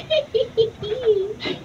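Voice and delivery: put-on soft girlish giggle; giddy giggle. Girl giggling to her friend about the professor. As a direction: giggle shyly at the thought.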